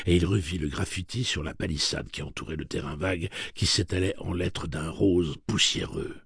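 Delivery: ordinary delivery, nothing marked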